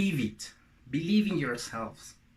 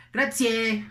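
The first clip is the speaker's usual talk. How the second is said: loud, high Mexican-accented take on Italian; tacky Mexican accent; 7 semitones higher, 6 dB louder than usual. Shown as a demonstration. Teacher shows how not to speak Italian, tacky Mexican accent. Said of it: "tacky Mexican".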